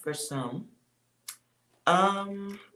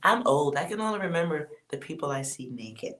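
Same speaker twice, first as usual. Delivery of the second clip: ordinary talk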